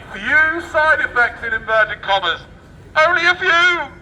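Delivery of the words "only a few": sarcastic exclaim. Acted. Sarcastic reading of news.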